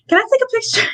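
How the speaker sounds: imitating a starstruck squeak, very high; mock starstruck nerves. Fan asking her idol for a picture, mock nervous squeak. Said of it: "just stuck there frozen".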